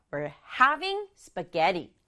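having and spaghetti punched, slow; stress on having and spaghetti. Shown as a demonstration. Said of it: "두 단어가 중요해요". (having과 spaghetti를 강조해서 천천히)

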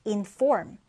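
"inform" said slowly with a clear stress; slow, careful model of "inform". Shown as a demonstration. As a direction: lean on the stressed syllable of "inform". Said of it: "강세를 두고".

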